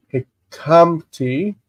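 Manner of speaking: hikamti drawn out, syllable by syllable; slow sounding-out. Shown as a demonstration. Teacher sounds out new form.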